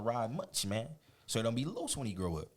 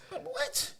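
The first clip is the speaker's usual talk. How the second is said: mock shocked what. Reacting to mama's order.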